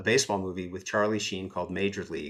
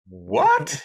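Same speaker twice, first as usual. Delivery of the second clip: squeaky, very high stunned what, loud; retold disbelief at a client; incredulous what; 8 dB louder than usual. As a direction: react like you can't believe your ears. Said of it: "it's just like".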